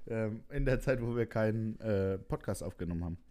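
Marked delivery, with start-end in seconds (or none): none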